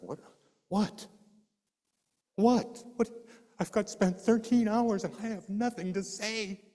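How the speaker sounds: high, urgent rising cries of what; frantic, searching for words; panicked pleading. Retold or imitated. Panicked self-quote asking God for words.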